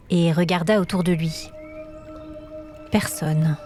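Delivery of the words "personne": usual speaking voice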